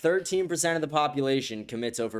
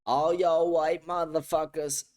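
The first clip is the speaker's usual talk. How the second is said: slow, higher mock echo of the woman's shout; slow mocking echo of the woman in the clip; mocking imitation; 5 semitones higher, slower than usual. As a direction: parrot her line back slowly to ridicule it.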